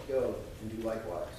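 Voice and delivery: ordinary delivery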